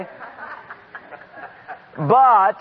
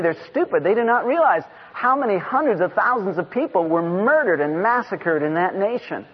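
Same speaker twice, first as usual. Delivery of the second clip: ordinary delivery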